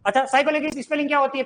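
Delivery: ordinary delivery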